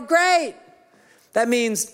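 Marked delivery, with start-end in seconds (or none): 0.0-0.5 high, bright one-word answer; cheery one-word answer. Retold or imitated. Pastor plays himself answering his wife, clipped and cheerful. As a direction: toss off a satisfied great.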